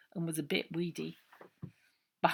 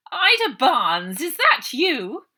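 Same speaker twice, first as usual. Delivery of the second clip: loud, slow, high shriek across the yard; 9 semitones higher, 16 dB louder, slower than usual. A narrator's reading. Matron catching a trespasser, shrieking loudly. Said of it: "Missus Buller's voice shrieked across the yard".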